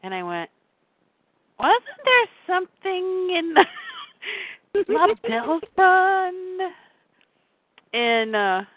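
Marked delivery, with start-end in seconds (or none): 1.6-6.7 eager realization. Retold or imitated. Sudden realization retold.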